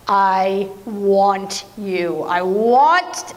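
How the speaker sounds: low, very slow, drawn-out "I want you"; a hint of a swooning lover, stretched out; lovesick king. Preacher voicing the king to his bride, slow and low.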